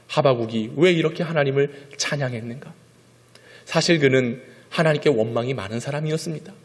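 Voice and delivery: ordinary talk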